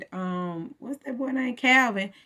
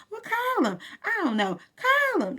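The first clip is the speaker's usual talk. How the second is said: 1 high mocking voice; 11 semitones higher than usual. Voicing a back-and-forth.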